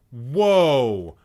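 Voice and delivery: loud, drawn-out whoa; shocked outburst. Real, not acted. Streamer seeing a friend's shocking rating, loud shock. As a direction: cry out in disbelief.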